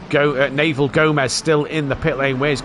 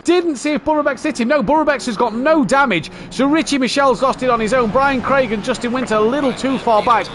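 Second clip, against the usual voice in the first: high, fast, excited race call; motorsport commentator; 9 semitones higher than usual. Real, not acted. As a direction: call the crash live, fired up. Live race commentary after crash.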